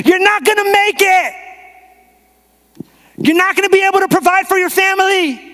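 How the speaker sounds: high, loud, drawn jeer; taunting yell. Acted. The enemy shouting discouragement at a believer, yelling. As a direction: yell it from far off, pitched high. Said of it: "And so he yells back here".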